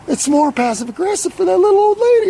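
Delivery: very high, loud, sarcastic really; outraged for the old lady, voice squeaking up; mock scandalized protest; indignant disbelief. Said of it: "I'm like".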